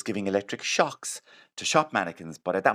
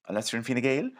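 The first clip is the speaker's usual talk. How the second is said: usual speaking voice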